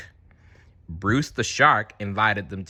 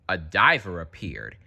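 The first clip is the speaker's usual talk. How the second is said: ordinary delivery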